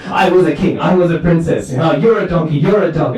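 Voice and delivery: high, heated back-and-forth bickering; acted squabbling yell. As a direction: play a couple yelling insults at each other. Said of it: "they start yelling at each other".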